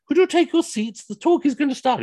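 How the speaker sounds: high, loud announcement; announcing to crowd. Retold or imitated. Organiser telling the ladies to sit, high loud call. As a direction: project high over the hall.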